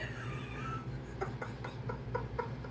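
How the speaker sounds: silent, wheezing laugh; breathless real laughter. Real, not acted. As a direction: laugh with barely any voice. Still laughing at the song.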